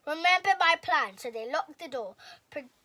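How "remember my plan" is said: loud, higher commanding shout. A narrator's reading. Owl shouting orders to his friends, loud. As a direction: make them remember.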